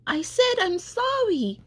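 high-pitched yell without much added volume. A narrator's reading. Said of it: "Now Rex was yelling".

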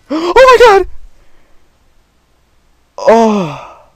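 very high shrieking scream; startled scream. Real, not acted. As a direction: scream at the jump scare. Scream at another scare.

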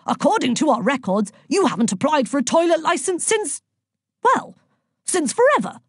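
very high-pitched bureaucrat; fussy official climbing in pitch; officious inspector. A narrator's reading. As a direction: recite the offence indignantly. Inspector recites the offence.

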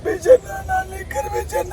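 high crying wail. Acted. Ramesh wailing to Pichanna over his liquor. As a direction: wail his name through fake tears. Weeping man answers friend.

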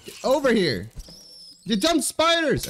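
high frustrated yell; angry yelling. Real, not acted. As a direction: yell at the spiders. Fighting spiders.

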